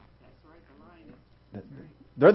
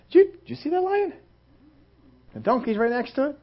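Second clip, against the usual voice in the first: amazed gossiping townsfolk; 7 semitones higher, 6 dB quieter than usual. Acted. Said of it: "they begin to talk about it".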